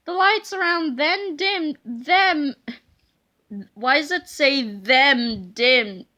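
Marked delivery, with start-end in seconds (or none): none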